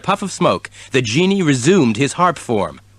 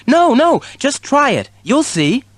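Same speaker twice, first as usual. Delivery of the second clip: kitten voice; 13 semitones higher than usual. A narrator's reading. Kitten voice in story.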